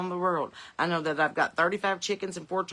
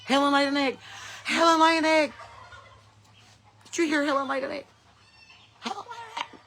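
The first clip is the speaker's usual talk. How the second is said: high, squawky hen voice, getting higher; excited hens; 10 semitones higher, 3 dB louder than usual. Retold or imitated. Chickens announcing Helen's egg to the flock, high and excited. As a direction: cluck out the news like a thrilled chicken. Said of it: "They're like".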